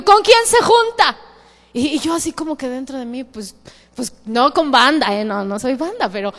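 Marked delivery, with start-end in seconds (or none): 0.0-1.2 high, loud startled question; mock alarm. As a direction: play up the listener's shock. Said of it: "me dijo".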